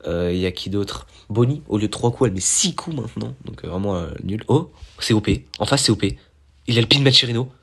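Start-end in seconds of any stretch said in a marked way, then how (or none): none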